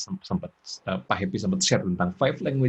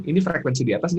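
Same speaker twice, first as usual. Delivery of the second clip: ordinary delivery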